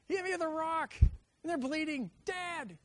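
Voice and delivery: put-on high, loud tearful kid yelling for dad; hurt child. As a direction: wail it like a kid with a bleeding cut. Kid calling dad after injury.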